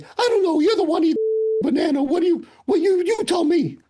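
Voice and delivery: high squeaky mocking grouch; Hammer's grumpy voice. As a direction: needle him like a cranky old barman. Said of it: "He's like".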